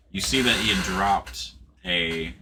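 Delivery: usual speaking voice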